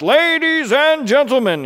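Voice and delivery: high, loud circus barker; big projected ringmaster announcement, stretched high; ringmaster's call. Acted. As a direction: sing out grandly to draw a crowd. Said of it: "he beckoned".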